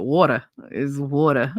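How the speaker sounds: water said as wooder, rounded vowel, no r; water with a broad Jersey aw vowel. Shown as a demonstration. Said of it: "the way I say it".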